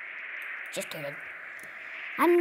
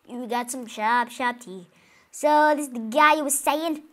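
ordinary talk, nothing marked

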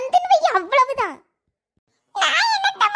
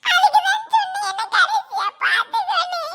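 crying; 5 semitones higher than usual. Acted. Child bidding Mahesh farewell before punishment, crying. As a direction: wail it as a doomed goodbye. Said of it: "யாமட்டி ஊதா அழுக?".

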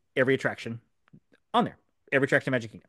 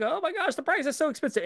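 high-pitched imitation of panicked customers; mock freak-out; 13 semitones higher, 3 dB quieter than usual. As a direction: mimic people fretting, voice way up. Mimics people freaking out over price.

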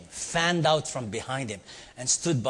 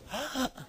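high, quick imitated gasp; comic frozen-in-shock gasp; 12 semitones higher, 6 dB quieter than usual. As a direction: gasp like a startled child. Kids' shocked Home Alone reaction.

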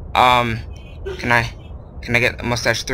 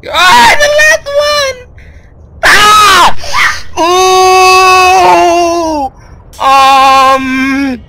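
very high loud drawn-out scream; terrified scream; 9 dB louder, much slower than usual. Real, not acted. Gamer screaming at the last scare, very high. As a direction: scream in real terror.

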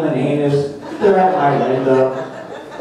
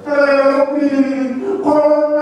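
dying fish; 11 semitones higher, much slower than usual. Retold or imitated. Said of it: "the last words I heard were".

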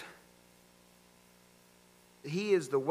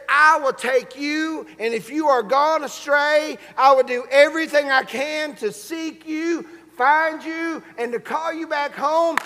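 raised, emphatic sermon voice; impassioned preaching; 5 semitones higher, 7 dB louder than usual. Real, not acted. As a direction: preach the promise with mounting force. Preaching Jesus's promise to congregation.

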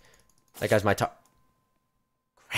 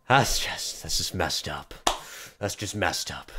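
low, soft, drained; deflated disbelief; 8 semitones lower, 6 dB quieter, slower than usual. Real, not acted. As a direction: let the voice sink after the outburst. Deflated after the outburst.

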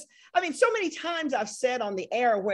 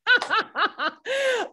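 loud high burst of laughter; real laughter; 11 semitones higher, 3 dB louder than usual. Real, not acted. Host laughs loudly.

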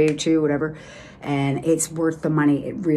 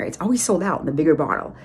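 usual speaking voice

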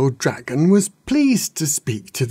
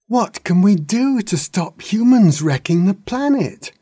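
put-on high, eager young dragons asking; keen little dragons pressing for an answer; excited young dragons ask the wise old dragon; 8 semitones higher than usual. A narrator's reading. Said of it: "asked the young dragons".

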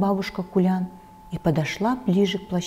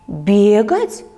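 surprised; 5 dB louder than usual. A narrator's reading. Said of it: "удивилась бабушка".

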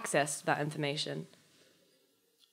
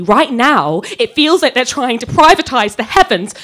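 much louder, indignant; passionate protest; 6 semitones higher, 11 dB louder than usual. Acted. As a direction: rail against the company. Ranting against the company.

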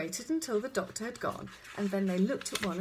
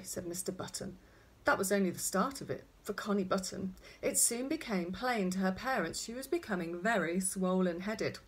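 plain reading voice

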